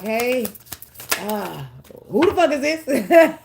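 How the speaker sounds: hammed-up who-is-this outrage, laughing through it; mock suspicious challenge. Spooked reader to a newcomer spirit, mock challenge laughing. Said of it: "you be like".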